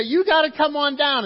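loud, high-pitched acted summons; excited call. Ladies back from the tomb calling disciples, excited. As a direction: call everyone over with urgent news.

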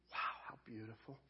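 soft, low wonder; hushed awe. Acted. Jesus looking at his bride, hushed awe. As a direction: breathe it out softly in admiration. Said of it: "It's with that same".

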